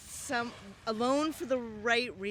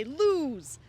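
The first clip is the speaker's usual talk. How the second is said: mock dice guys; 6 semitones higher, 7 dB louder than usual.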